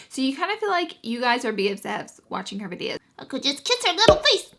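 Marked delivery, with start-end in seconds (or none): 3.2-4.5 cutesy gush. Real, not acted. Creator gushing to viewers about collab partner, squealy cutesy voice.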